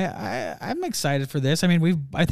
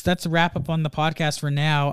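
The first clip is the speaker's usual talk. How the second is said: ordinary delivery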